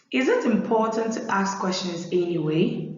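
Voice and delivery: normal talking voice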